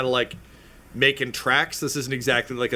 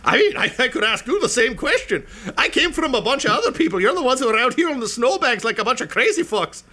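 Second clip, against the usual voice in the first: high, bright-toned gnome chatter, much higher; 10 semitones higher, 4 dB louder than usual. Acted. Gnome answering the strangers on the snowbank, high. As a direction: keep the gnome's high squeaky voice.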